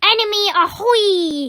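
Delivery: loud, high call across the deck; ringing pirate hail; pirate lookout cry. Acted. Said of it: "he cried".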